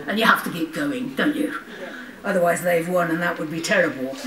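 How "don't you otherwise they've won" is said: ordinary talk, nothing marked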